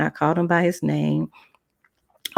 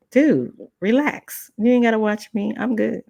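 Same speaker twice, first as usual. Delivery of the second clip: put-on teen brush-off; 6 semitones higher than usual. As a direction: wave him off lazily. Brushing off overprotective teacher.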